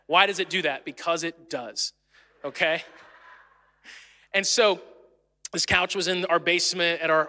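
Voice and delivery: ordinary talk, nothing marked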